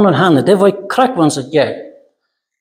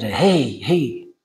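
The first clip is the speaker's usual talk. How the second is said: soft, low-key shushing; hushing; 3 semitones lower, 6 dB quieter than usual. Retold or imitated. Crowd hushing the blind men, soft retold shushing. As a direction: quietly try to settle someone down. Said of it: "Die beschwächt er".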